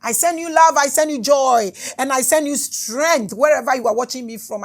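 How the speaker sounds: lightly chanted blessing; spiritual blessing. Acted. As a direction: send love out like a prayer.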